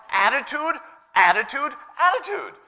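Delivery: high, forceful, word-by-word insistence; emphatic retold warning. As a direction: drum the word in, pitch climbing. Retelling the apostle's repeated warning.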